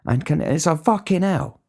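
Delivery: high exasperated yelp of disbelief; exasperated outburst. Real, not acted. Cook vents about fussy orders, high exasperated yelp. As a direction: throw up your hands, voice cracking up high.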